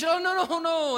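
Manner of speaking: high-pitched, insistent mock refusal; acted protest. Arguing with God about His assignment, acted protest. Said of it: "And you begin to argue".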